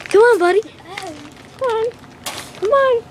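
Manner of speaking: high, coaxing pet voice. Acted. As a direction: coax the monkey out gently. Coaxing the monkey out.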